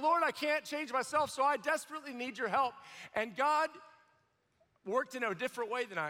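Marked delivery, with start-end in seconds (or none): 0.0-2.7 pleading, pitched up and louder; desperate prayer. Retold or imitated. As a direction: cry out to God for help. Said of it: "and I was like".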